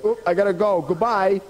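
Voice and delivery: high, tossed-off farewell; hurried goodbye. Acted. Dog leaving rabbit for the boat, hurried goodbye. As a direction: call it out while leaving.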